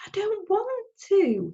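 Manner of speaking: put-on high, slow, stubborn boy's voice; insisting boy. Boy refusing his father's plan, high and stubborn. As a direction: dig in like a stubborn kid. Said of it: "he insisted".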